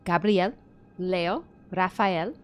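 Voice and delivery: careful French names, one at a time; unsure French accent; attempted French pronunciation. Real, not acted. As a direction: read each name with a tentative French accent. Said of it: "please forgive my pronunciation".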